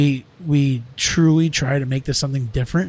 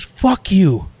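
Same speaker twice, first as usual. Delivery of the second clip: retold angry curse; 4 semitones higher, 5 dB louder than usual. Acted argument, employee repeats curse.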